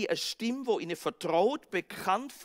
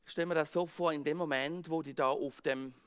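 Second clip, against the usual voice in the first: normal talking voice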